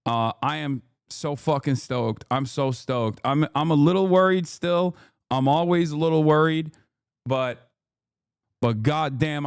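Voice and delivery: ordinary talk, nothing marked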